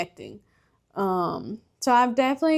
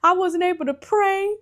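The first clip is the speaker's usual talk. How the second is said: higher, excited mom voice; imitating mama; 9 semitones higher, 3 dB louder than usual. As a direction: voice your mom, surprised and a bit scolding. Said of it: "She's like".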